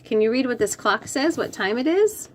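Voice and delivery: ordinary delivery, nothing marked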